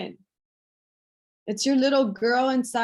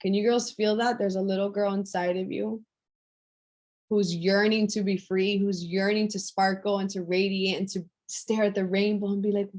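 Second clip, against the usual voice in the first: normal talking voice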